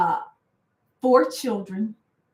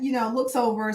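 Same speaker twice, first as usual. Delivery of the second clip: ordinary talk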